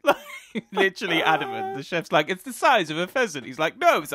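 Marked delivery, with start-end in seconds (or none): 2.3-3.5 lightly voiced mock Italian chef; a slightly higher, louder hint of an Italian chef. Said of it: "the chef was mock Italian". 3.8-4.1 higher, louder mock Italian protest; mock Italian chef. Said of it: "the chef was mock Italian".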